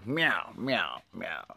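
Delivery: soft imitated meowing; cat meows. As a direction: meow like a cat.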